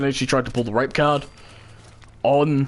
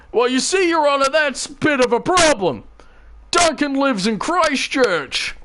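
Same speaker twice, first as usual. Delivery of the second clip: dramatic reveal; 13 semitones higher than usual. Acted. Friend revealing the alibi to the judge, high and showy. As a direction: deliver the twist with flair.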